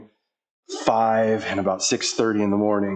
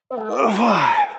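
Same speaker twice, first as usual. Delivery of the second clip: counting reps through effort; straining; 9 semitones higher, much slower than usual. Real, not acted. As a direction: push each number out under the load. Counting reps under heavy strain.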